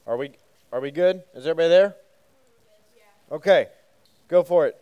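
loud call across the room. Real, not acted. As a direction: raise your voice to reach everyone at the back. Calling out to the room.

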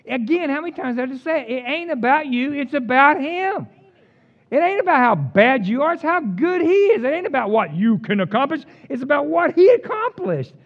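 full-voiced preacher hammering the point home; preaching at full force. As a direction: build it into a fiery crescendo. Preaching climax.

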